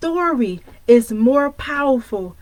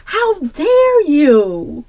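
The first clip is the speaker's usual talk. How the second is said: slow, drawn-out scolding mother, louder; mother's voice; 3 semitones higher, 5 dB louder, much slower than usual. Retold or imitated. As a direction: stretch it out like mom does. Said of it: "I'ma say it in my mother's voice".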